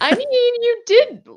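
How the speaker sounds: very high, loud protest; high-pitched pointed objection, hammed-up; mock incredulous. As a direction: squeak it out in disbelief. Her incredulous retort to the characters.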